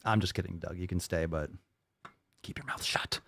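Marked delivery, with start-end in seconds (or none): 2.4-3.2 whispered warning; hushed aside. Real, not acted. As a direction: whisper it under your breath to a heckler.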